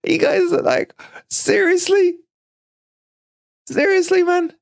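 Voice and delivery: very high, incredulous laughing squeal; laughing disbelief. Real, not acted. Incredulous laughter at viewers' harshness.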